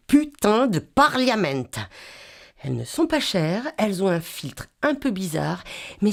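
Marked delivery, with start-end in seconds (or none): 0.1-1.7 exasperated. A narrator's reading. Exasperated narrator.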